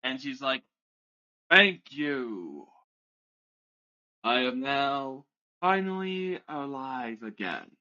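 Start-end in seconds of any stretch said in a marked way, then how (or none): 1.5-7.8 a mildly booming dragon, drawn out; lightly voiced grand being speaking in a booming voice. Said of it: "you suddenly hear a foaming voice".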